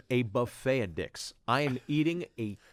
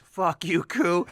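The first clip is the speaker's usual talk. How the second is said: higher, louder teasing call; louder mock heckle from the table; playful loud jab; 7 semitones higher, 6 dB louder, much slower than usual. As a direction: shout the heckle across the table. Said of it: "Mario says".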